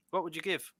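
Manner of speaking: ordinary delivery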